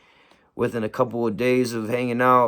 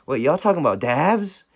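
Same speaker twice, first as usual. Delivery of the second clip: a higher, eager mock voice of his friend; lightly voiced buddy perking up at the word dabs; imitating friend Kenny; 6 semitones higher, 3 dB louder than usual. Friend overhearing talk of dabs, imitated with higher, eager voice. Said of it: "he was like".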